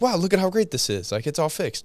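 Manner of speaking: imitating mom proud of her fix; cheerful mom. Mom celebrating the repaired washing machine, excited.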